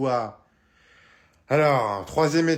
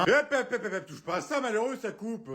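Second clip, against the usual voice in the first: put-on higher, laughing while stopping the child; laughing warning; 7 semitones higher than usual. Dad laughing as he stops his son grabbing the knife. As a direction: laugh as you shoo the kid off.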